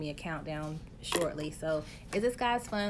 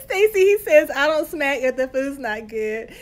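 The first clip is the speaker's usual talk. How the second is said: laughing; 7 semitones higher, 13 dB louder than usual. Real, not acted. Relaying husband's joke, laughing.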